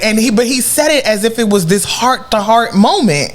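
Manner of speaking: normal talking voice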